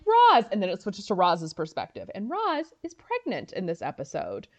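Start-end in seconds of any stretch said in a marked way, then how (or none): 0.1-0.4 high, loud name call; sharp, high "Roz"; exasperated call. Retold or imitated. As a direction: call her name, caught off guard. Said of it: "And he's like".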